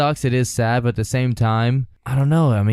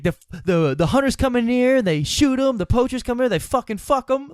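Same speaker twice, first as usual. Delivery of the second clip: much higher, indignant mock rant; imitating Treadwell; 12 semitones higher than usual. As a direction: rant it in high, outraged falsetto. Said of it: "he's like".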